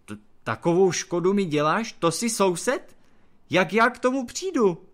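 high, loud indignant rant; angry outburst. A narrator's reading. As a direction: lash out at the neighbour. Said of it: "zle se na Krakonoše obořil".

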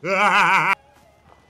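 sudden, very loud cackle; loud outburst. Real, not acted. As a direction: burst out at full volume. Sudden loud reaction while gaming.

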